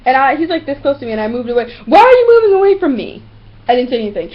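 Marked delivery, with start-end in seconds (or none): 1.9-3.2 high, loud whining demand; mock indignant yell. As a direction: imitate a needy pest making a scene. Imitates man's indignant demand.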